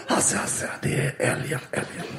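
hushed urgent whisper. Retold or imitated. Audience member whispering the answer to Hasse. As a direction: whisper it secretly across the room. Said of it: "någon som viskar bara".

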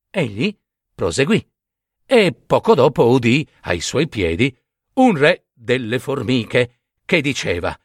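ordinary delivery, nothing marked

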